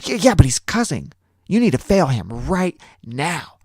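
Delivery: high, loud insistent demand; mock adamant TA. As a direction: insist like a spoiled girl, pitched high. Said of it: "this girl was adamant".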